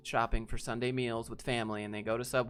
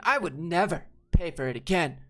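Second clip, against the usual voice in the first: higher, louder put-on indignant voice; indignant customer; 4 semitones higher, 6 dB louder than usual. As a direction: rant it in a shrill entitled voice. Customer refuses to pay.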